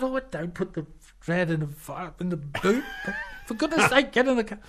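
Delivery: high, rising fluster. Retold or imitated. [name] refusing to put his violin in the boot, high and flustered. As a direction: flap and urge them into the car. [name] urging him, alarmed.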